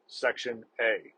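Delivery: "Section A" spelled out slowly, word by word; slow dictation. Retold or imitated. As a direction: drag out "Section A" like someone writing it down by hand.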